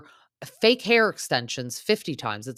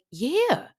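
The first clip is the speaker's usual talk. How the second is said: much higher, louder sarcastic retort; sarcastic rebuttal in a much higher voice; sarcastic yeah we know; 6 dB louder, much slower than usual. Retold or imitated. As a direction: roll your eyes and shoot it back way up high. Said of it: "And it's like".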